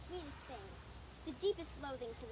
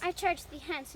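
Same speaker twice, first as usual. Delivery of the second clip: angry rebuff; 3 semitones higher, 10 dB louder than usual. Acted. Demetrius ordering Helena off, loud and angry. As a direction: snap it out, much louder.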